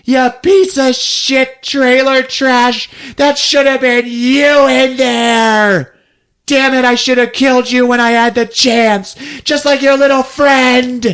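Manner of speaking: high, loud, drawn-out mock rant; hammed-up hysterical rage; screamed tirade. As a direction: screech insults at a rival. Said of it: "she just yells to the crowd".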